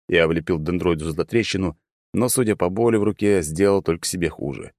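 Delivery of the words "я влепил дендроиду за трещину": usual speaking voice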